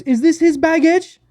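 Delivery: put-on high, slow French-accented question; imitated French accent. French tour manager asking about luggage, imitated French accent. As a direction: mimic a French woman, pitched high. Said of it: "making fun of her accent".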